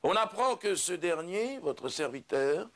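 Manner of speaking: slow, grandiose stage declamation; solemn proclamation. Acted. Judas revealing himself to the humans, solemn proclamation. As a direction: declaim it like a grand revelation, drawing out phrases.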